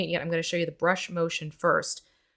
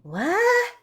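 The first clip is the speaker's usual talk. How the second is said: much higher, startled; surprised; 14 semitones higher, 4 dB louder than usual. Real, not acted. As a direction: blurt it out in shock. Surprised reaction.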